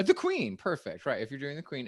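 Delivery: usual speaking voice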